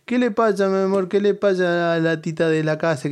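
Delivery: put-on high cooing baby talk. Wife cooing to the dog Tita, high baby talk. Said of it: "o le dice".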